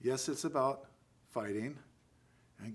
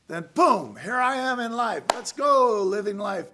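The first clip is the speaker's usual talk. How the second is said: loud high burst of energy; excited; 8 semitones higher, 11 dB louder than usual. Acted. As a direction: shout it out like life exploding in. Bursting into life metaphor.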